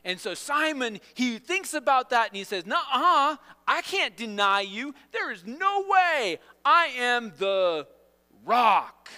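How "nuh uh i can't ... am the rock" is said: loud, slow, high protest; over-the-top bragging Peter; boastful refusal. Acted. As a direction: hammer each word with swaggering confidence. Acting boastful Peter contradicting Jesus.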